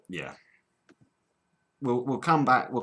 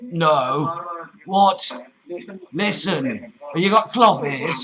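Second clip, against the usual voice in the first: loud, higher Cockney irritation; mock exasperation; 4 semitones higher, 5 dB louder, slower than usual. Cockney prank caller scolding the mishearing agent, exasperated. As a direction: demand to be listened to.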